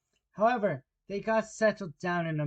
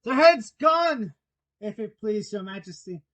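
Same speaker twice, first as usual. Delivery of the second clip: loud, high report, easing at the end; shouted reply; 7 semitones higher, 12 dB louder than usual. Acted. Soldiers reporting back to the Queen, shouting. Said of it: "the soldiers shouted in reply".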